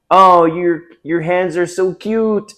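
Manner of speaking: high, sugary mock-sweet voice; mock girlfriend voice. Man mimicking his girlfriend's sweet compliment, high and mocking. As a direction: parody her cooing praise, slow and high.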